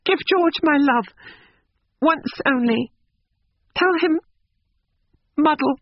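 high, halting character voice; tearful farewell. A narrator's reading. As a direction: give a last message through tears, pitched high. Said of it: "while the tears poured over her cheeks".

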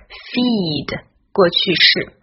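usual speaking voice

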